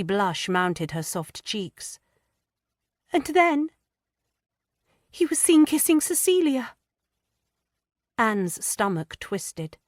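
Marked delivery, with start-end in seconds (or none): 3.1-6.7 put-on high, hesitant girlish voice, stammering; a frightened young wife struggling to confess; young distressed woman. A narrator's reading. Lydia hesitantly confiding her fears to Anne.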